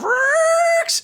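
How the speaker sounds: high, sharp imitated screech of tires; squealing brakes sound effect. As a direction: mimic the car screeching to a stop. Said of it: "he went".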